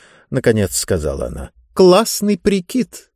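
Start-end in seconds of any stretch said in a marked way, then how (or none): 1.7-3.1 slow, higher-pitched cool praise; lightly voiced schoolgirl slang; girl's slang compliment. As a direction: offer it as a trendy compliment. Girl offers her slang compliment.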